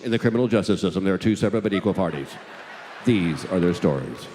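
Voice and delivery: deep, loud announcer voice, slowing at the end; TV show intro. Acted. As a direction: intone it like a crime-show opening. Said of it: "I can sing you the song".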